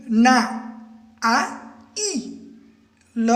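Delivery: ना, आ, ई, ल as separate sounds with pauses; sounded out. Shown as a demonstration. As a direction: break नाइल into ना, आ, ई, ल, one sound at a time. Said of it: "देखो". (ना, आ, ई, ल अलग-अलग ध्वनियों में)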